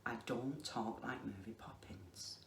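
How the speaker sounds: ordinary delivery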